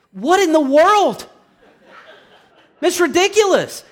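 high, incredulous cry; exasperated outburst. Real, not acted. Preacher reacts to judging by appearance, exasperated outburst. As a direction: burst out in indignant disbelief.

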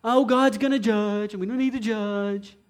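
droning put-on mimicry, softening at the end; mock objection. Preacher mimics what he has heard so much, mocking drone. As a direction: parrot it wearily in a put-on voice. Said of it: "I've heard that so much".

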